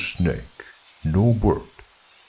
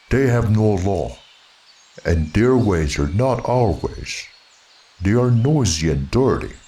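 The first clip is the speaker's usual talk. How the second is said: angry bear. Acted. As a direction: lecture the boy firmly. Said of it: "said Baloo angrily".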